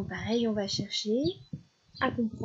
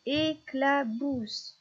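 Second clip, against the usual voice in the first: "éclabousse" slowly, in three spaced beats; syllable by syllable; 4 semitones higher, much slower than usual. Shown as a demonstration. Teacher counting three syllables for a child, "éclabousse" slowly split. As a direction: separate "éclabousse" into é-cla-bousse.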